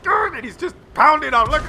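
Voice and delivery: high, loud frantic complaint; furious little tank. Retold or imitated. Commentator voicing the pushed tank, high angry rant. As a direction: rant in the tank's squeaky rage.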